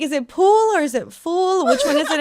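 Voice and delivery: high and loud, puzzled and eager; a lively imitation of a confused caller; puzzled excited friend. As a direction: ask it urgently, like it really matters. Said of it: "he's like".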